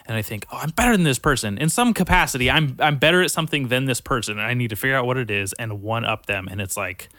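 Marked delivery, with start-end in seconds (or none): 0.5-6.5 fired-up pep talk. Retold or imitated. Competitive inner voice.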